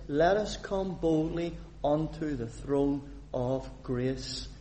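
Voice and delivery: normal talking voice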